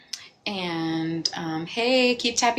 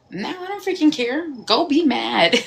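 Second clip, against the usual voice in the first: defiant dismissal; 6 semitones higher, 9 dB louder than usual. Retold or imitated. Telling the angry person off, a loud defiant brush-off. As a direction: toss their anger back at them, unbothered. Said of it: "I was like".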